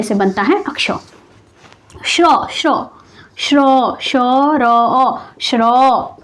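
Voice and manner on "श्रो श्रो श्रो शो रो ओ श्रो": श्रो split into शो, रो, ओ then rebuilt as श्रो; sounding out shrō. Shown as a demonstration. Teacher sounding out श्रो so students hear its parts. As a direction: break श्रो into its sounds and join them again. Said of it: "आप उच्चारण कीजिएगा तो उससे भी आपको पता चलेगा".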